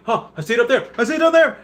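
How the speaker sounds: high, rising mock confusion; confused garage search. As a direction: act lost in a parking garage.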